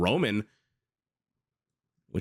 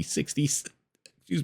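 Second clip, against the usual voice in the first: hiccups breaking the words; talking through the hiccups; hiccuping; 4 semitones higher, much slower than usual. Real, not acted. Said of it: "I don't know why I started hiccuping".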